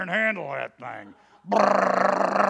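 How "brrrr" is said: rolling lips brrr like a machine gun; spray the room with a mouth machine gun; loud mock gunfire burst; gunfire noise. Imitating machine gun fire.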